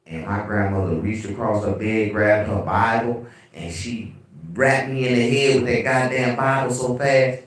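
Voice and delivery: normal talking voice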